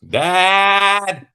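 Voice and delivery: high, loud, put-on voice calling out to the heavens; hollering up to an imaginary father; mock call to Dad. Mocking Mario, calling out to his Dad in a high, loud put-on voice.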